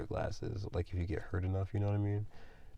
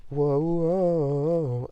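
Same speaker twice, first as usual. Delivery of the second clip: startled whoa; 9 semitones higher, 10 dB louder than usual. Real, not acted. Page rips while squashing spider.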